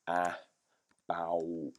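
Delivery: a, bow sounded slowly piece by piece; sounding it out. Shown as a demonstration. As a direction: sound out each part while writing. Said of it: "saying it out loud as they write".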